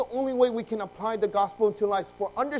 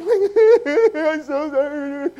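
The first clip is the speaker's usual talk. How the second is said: loud, high, drawn-out wail; mock crying; 10 semitones higher, 11 dB louder, much slower than usual. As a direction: bawl the apology in hammed-up sobs. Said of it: "we both are crying".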